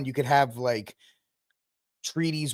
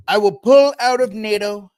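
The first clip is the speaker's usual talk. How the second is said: louder, higher Trump voice hammering "pull"; Trump impression; 6 semitones higher, 8 dB louder than usual. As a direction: bluster the threat like Trump. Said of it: "he'll float it out".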